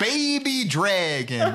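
high, drawn-out cartoon New York call; Joey Wheeler impression. Summoning a card as a joke, Joey Wheeler impression. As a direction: cry it like a hammy anime duelist. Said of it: "We definitely did Joey Wheeler".

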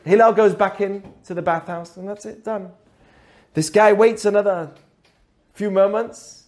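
ordinary talk

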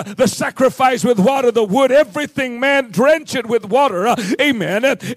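normal talking voice

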